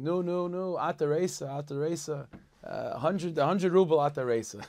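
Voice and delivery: high, loud jubilant shout; retold drunken yell. Drunk man in the back of shul yells out, loud and high. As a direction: belt it out like a drunk mistaking the day for a festival. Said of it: "he yells out screaming".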